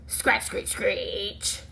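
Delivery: put-on bright, lively reading to children; storybook read-aloud. A narrator's reading. Teacher reading a picture book to her class, lively. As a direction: read it like story time for little kids.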